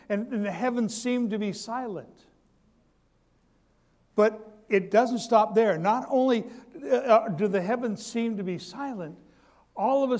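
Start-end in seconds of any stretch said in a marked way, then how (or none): none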